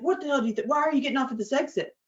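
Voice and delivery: high, fast mock cop questioning; angry cop. Cop demanding why she took the exit, angry. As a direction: grill her quickly like a cop. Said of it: "he's like".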